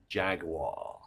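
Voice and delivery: Jaguar said the British way, JAG-wah. Shown as a demonstration. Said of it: "Love the way ... you Americans say".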